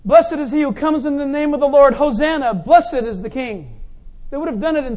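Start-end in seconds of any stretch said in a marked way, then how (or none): 0.0-3.8 loud joyful proclamation; crowd acclaim. Acted. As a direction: call it out like the cheering crowd. Crowd's psalm shout, acted.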